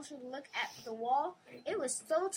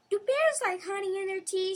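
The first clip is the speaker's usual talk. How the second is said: high, louder, eager wonder; excited wonder; 7 semitones higher, 9 dB louder than usual. Acted. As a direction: bubble over with curiosity. Child wondering aloud.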